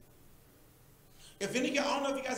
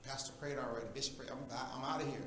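dismissive mutter; 9 semitones lower, 7 dB quieter, faster than usual. Retold or imitated. Mocking members leaving church.

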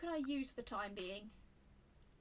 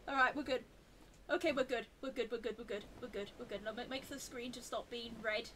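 normal talking voice

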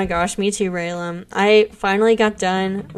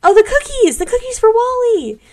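much higher, loud cry of discovery; excited realization; 12 semitones higher, 7 dB louder than usual. Real, not acted. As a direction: shout it as it clicks. Suddenly remembers the cookies.

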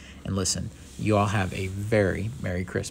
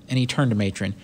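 ordinary delivery, nothing marked

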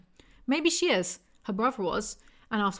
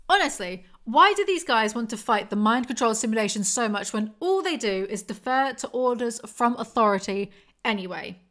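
ordinary talk

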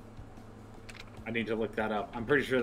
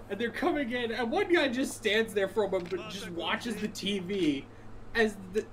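ordinary talk

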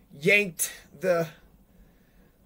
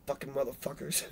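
muttered curse; 6 semitones lower, 14 dB quieter than usual. Real, not acted.

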